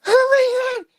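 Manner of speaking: slow, gushing falsetto of a doting sister; Marty talking like she's twelve. Acted. Sister praising brother's garage project, mock little-girl voice. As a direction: coo admiringly in a tiny, very high voice. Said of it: "She talks like she's twelve".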